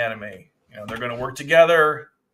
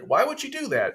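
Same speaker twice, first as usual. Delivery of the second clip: much higher, incredulous; mock shocked question; 13 semitones higher than usual. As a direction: imitate his stunned disbelief. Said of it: "He's like".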